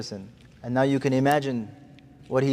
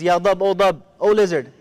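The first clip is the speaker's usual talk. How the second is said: raised, ringing call to the lizard; 3 semitones higher, 6 dB louder than usual. Acted. The Prophet loudly calls the dead lizard to speak. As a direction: call it out loud across the gathering.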